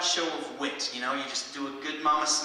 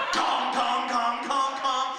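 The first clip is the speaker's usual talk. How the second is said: mic hit sound; 9 semitones higher, 3 dB louder, slower than usual. Retold or imitated.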